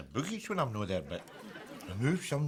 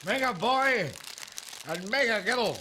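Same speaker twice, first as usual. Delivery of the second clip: mock-excited showman; 8 semitones higher, slower than usual. Visitor trying to win over the silent children, mock-excited. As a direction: hype the sweets like a toy advert.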